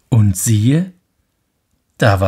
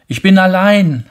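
high loud lonely call; 11 semitones higher, 9 dB louder than usual. Acted. Prince shouts to echo, loud high call. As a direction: cry it out across the peaks.

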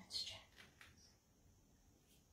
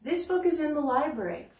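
ordinary talk, nothing marked

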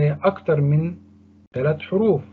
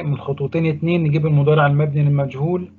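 ordinary talk, nothing marked

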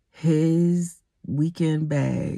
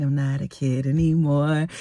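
ordinary delivery, nothing marked

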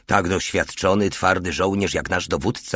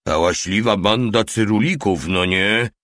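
a lightly voiced grumble from the small soldier; understated sardonic mutter; 3 semitones higher, 3 dB louder than usual. A narrator's reading. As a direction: mutter it half to yourself, sardonic. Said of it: "mruknął mały".

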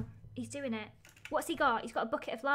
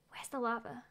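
unvoiced, hushed whisper; 7 dB quieter than usual. Real, not acted. Sneaking around looking for lava, whispered. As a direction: whisper it to yourself.

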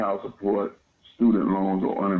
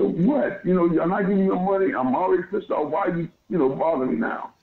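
high, fast retold yell at the cop; replaying his outburst, hammed-up and heated; angry yelling; 9 semitones higher than usual. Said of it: "and I'm yelling".

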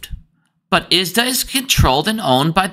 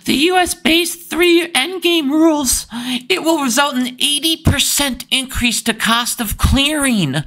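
high, whiny nasal-free mockery, much higher pitch; mock whining; 9 semitones higher than usual. Mocking the ISDA Twitter poster, high whiny voice. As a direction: whine it out like a sulky banker. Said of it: "and he's whining".

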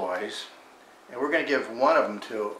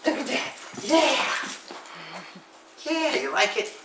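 high sing-song pet talk; dog-directed voice; 6 semitones higher than usual. Real, not acted. Praising the dog.

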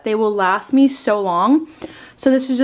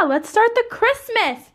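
excited; 11 semitones higher, slower than usual. Real, not acted. Opening her Christmas house tour.

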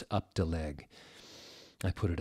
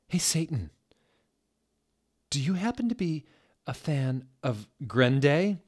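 higher, bright flirty lilt; 7 semitones higher than usual. Acted. Heroine flirting with Satan in a higher voice. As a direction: flirt up at him playfully.

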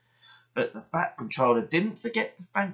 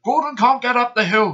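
urgent porter; 9 semitones higher, 10 dB louder than usual. Acted. Porter reporting trouble.

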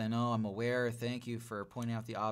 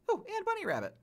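much higher, thrilled little cry; delighted squeal; faster than usual. Real, not acted. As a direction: coo at the rabbit in delight. Delighted to spot a rabbit.

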